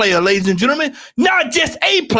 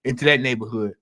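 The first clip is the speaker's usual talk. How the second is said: low, deep fatherly caution, dropping softer; parent's warning; 13 semitones lower, 3 dB quieter, faster than usual. Retold or imitated. Dad warning his son off a neighborhood, deep and stern. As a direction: warn your boy like a stern dad. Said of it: "They say".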